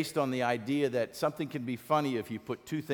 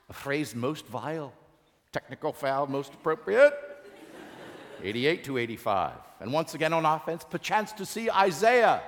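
theatrical Elizabethan sports commentary; Shakespearean play-by-play. Acted. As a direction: call the game like a Shakespeare actor. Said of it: "What would it sound like if William Shakespeare did the play-by-play".

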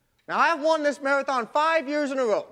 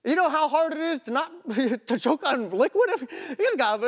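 usual speaking voice

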